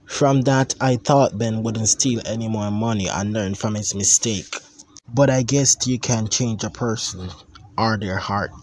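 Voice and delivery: ordinary delivery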